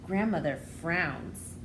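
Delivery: plain reading voice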